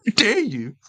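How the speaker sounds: high, outraged mock scolding; Greta impersonation. Streamer mocking a city claim, Greta impersonation. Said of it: "I'll do my Greta impersonation".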